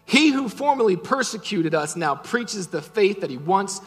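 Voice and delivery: loud, driving preacher voice building to a peak; full-force preaching. Acted. Pastor preaching grace to the congregation, loud and driving. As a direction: preach it hard to the whole room.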